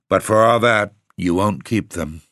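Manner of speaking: usual speaking voice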